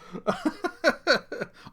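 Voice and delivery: high burst of chuckling; real laugh. Real, not acted. Man laughing at his years of training, real laugh. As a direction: crack up at yourself.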